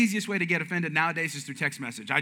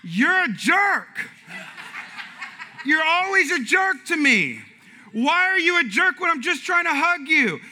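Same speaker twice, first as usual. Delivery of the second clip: loud, high, drawn-out mock accusation; hammed-up aggrieved yelling; offended shout; 10 semitones higher, 5 dB louder, slower than usual. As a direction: shout it as the wounded husband misreading a text. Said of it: "You know how I took it?".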